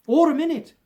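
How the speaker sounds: "or a minute" flung out high and loud; rising alarm. A narrator's reading. Narrator to listeners, the bells ring on endlessly: "or a minute" burst out high and loud.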